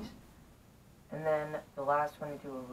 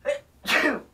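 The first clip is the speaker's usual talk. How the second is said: loud classic achoo sneeze; big high sneeze, full force; sneezing; 13 semitones higher, 15 dB louder than usual. Shown as a demonstration. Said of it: "The class claw chew sound first".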